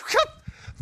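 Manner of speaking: put-on high, giddy childlike laugh; a squeal of delighted laughter; excited giggle. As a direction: giggle like a thrilled kid. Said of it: "Matt was like an excited child".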